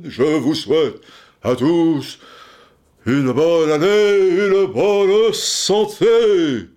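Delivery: solemn de Gaulle wishes, stretched slow at the end; grand, drawn-out Gaullian blessing; de Gaulle impression. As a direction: bestow New Year wishes like the general. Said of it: "intonation gaulienne".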